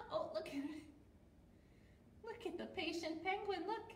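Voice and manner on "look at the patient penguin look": ordinary delivery, nothing marked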